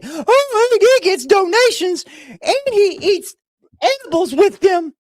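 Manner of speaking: high whiny imitation of the haters; whiny mock complaint. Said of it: "they cry 'cause I get donations".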